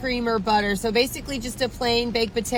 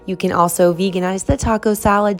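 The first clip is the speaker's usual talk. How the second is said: louder upbeat presenter read; outro read; 4 semitones lower, 5 dB louder than usual. Acted. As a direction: pitch it like a host signing off. Outro tips and sign-off.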